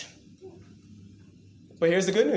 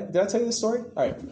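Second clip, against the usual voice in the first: ordinary delivery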